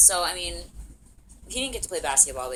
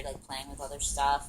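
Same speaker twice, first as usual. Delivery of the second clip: ordinary talk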